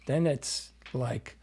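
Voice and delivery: usual speaking voice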